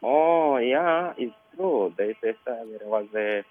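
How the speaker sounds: usual speaking voice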